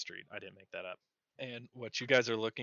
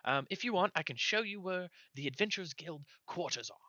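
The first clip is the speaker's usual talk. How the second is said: high, fast gnome stammering; flustered gnome; 7 semitones higher than usual. Acted. Gnome messenger stammering a message, high and squeaky. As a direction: squeak and stumble like a nervous gnome. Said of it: "a high squeaky voice".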